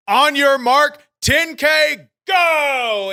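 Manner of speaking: high, slow, shouted like a ring announcer. Acted. Host hyping the contest to viewers with a big announcer shout. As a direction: belt out the show name.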